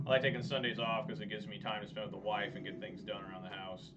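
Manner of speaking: ordinary delivery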